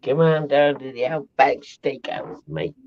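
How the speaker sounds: mock Australian ad pitch; joke-sell it in an Aussie accent. Joins in the mock Aussie bit.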